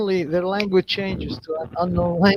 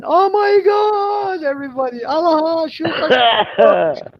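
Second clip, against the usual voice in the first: high, drawn-out exclamations of relief; crowd crying out; 9 semitones higher, slower than usual. Retold or imitated. Family finds the lost child, crying out in relief. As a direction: wail thanks, imitating an overjoyed crowd.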